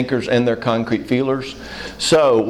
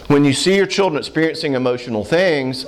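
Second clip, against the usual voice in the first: usual speaking voice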